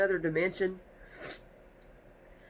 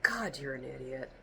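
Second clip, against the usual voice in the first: contemptuous look; 6 semitones lower, 8 dB quieter than usual. Retold or imitated. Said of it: "give you this look like".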